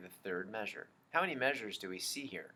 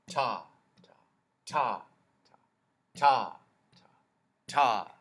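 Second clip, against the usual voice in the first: some ta punched louder than the rest; accented ta; 9 dB louder than usual. Shown as a demonstration. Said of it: "An accented note just has more energy".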